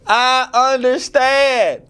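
high, drawn-out mock wail; overwhelmed realization. Singer reliving his revelation at night, overwhelmed. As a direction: stretch it slowly into a high, overcome cry. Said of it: "And I was like".

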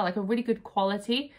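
ordinary delivery

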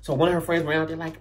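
usual speaking voice

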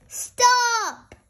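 high, loud sudden "Stop!"; a builder yelling stop across the playground; shout. Acted. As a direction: bark it out to halt them. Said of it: "shouts Mister Bull".

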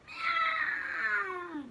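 high, loud pitiful meow; high pained cat wail; shrill cat meow. Acted. Cat being shaved.

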